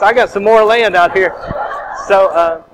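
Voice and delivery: loud, laughing through it; chuckling with delight at the windfall. Real, not acted. Gleeful about free land.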